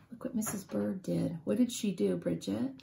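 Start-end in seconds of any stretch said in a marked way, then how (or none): none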